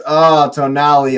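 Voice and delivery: ordinary delivery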